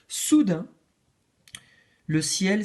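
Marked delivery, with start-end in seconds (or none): none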